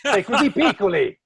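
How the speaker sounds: high, loud mock astonishment in Italian; amazed exclamation. Italian man seeing two tiny translators, mock amazement. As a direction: marvel out loud, hammed up.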